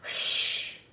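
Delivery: unvoiced hushing hiss. A narrator's reading. The thieves hushing Tom inside the house. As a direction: hush him urgently. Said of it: "said the thieves".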